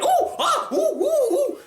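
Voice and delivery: very high excited oohs; hammed-up high-pitched gasps; mock Amy Poehler squeals. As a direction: parody an over-eager voice actress. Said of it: "every line that she says, she's like".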